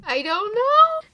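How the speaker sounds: high, loud, slow wail; exasperated wail. Real, not acted. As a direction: wail it in despair. Wailing exasperation.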